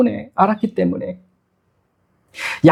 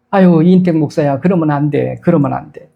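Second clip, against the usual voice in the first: gentle divine rebuke; 6 semitones lower than usual. Acted. God gently rebuking the pastor.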